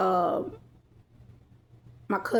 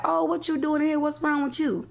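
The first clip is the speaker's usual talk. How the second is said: higher-pitched quoted greeting; imitating auntie; 7 semitones higher than usual. Auntie questioning her at the pharmacy, higher imitating voice. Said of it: "She said".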